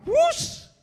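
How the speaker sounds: sharp high whoosh of a flying plate; whoosh sound. Retold or imitated. Preacher imitating a thrown plate, high whoosh. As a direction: mimic a spinning plate whizzing past.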